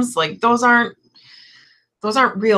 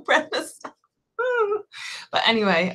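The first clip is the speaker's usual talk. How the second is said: ordinary talk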